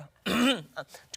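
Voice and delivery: a rough throat clear. Real, not acted. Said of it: "Gotta clear it".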